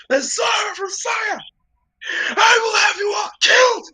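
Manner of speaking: high, loud royal outrage, screaming at the party; furious royal screech at the party; outraged shout. Acted. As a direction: shriek it like an insulted king. GM voices outraged elven king.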